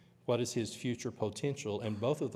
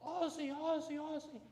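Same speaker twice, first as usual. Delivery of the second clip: very high, softened mock yell, fading; imitated holler; 5 dB quieter than usual. Preacher imitating brother cheering alone at windy tennis match, mock holler. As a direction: mimic a far-carrying cheer without real volume. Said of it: "at the top of his lungs".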